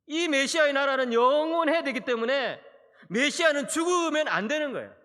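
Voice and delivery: emphatic preaching. Real, not acted. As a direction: preach it with full force. (힘주어 외치는 설교)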